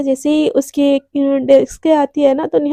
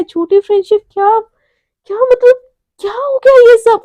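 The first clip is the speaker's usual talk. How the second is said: high, louder, bewildered questions; stunned confusion; 8 semitones higher, 5 dB louder than usual. A narrator's reading. Radhika shocked by the fake friendship charge, bewildered. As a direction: ask in raised, halting disbelief. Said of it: "Radhika को समझ नहीं आता".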